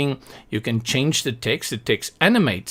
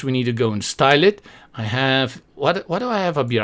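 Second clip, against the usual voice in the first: normal talking voice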